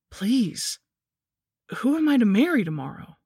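high, swooping, desperate plea; pleading. A narrator's reading. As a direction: beg for an answer, voice rising high. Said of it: "I pleaded".

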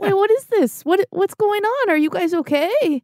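worried squeaky little pig; high, anxious pig voice; high alarmed pig voice. Acted. Beef alarmed at spider beds.

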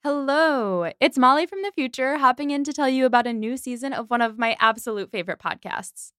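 loud bright promo voice; cheery sponsor read. Acted. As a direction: pitch the other podcast upbeat. Podcast ad read.

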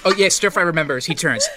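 normal talking voice

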